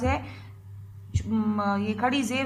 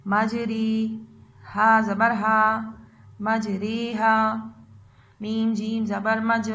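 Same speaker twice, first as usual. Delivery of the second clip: slow spelling drill; much slower than usual. Shown as a demonstration. Teacher drilling children through a word, slow spelling. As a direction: spell it out slowly so children repeat. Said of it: "पढ़ाई करते हैं".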